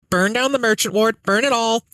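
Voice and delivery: very high, squawky parrot shriek; chirpy parrot. Acted. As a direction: chirp the words like a gleeful parrot. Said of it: "a happy chirping noise".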